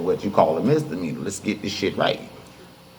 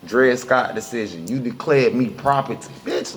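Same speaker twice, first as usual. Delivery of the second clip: normal talking voice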